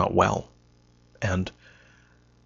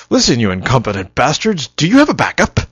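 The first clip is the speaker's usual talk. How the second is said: mock scolding; 5 semitones higher, 8 dB louder than usual. Telling off the subcontractor, imagined.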